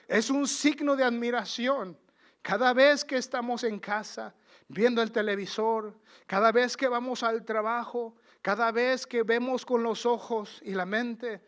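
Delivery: forceful preaching. Acted.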